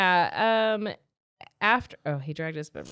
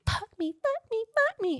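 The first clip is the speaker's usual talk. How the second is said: very high, squeaky little pleas; hammed-up needy heroine in falsetto; mock lustful begging.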